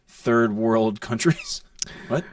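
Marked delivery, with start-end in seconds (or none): none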